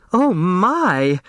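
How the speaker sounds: high, alarmed little cry; startled old lady voice; startled gasp. A narrator's reading. Flora alarmed by doors opening.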